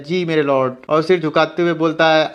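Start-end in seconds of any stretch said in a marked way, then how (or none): none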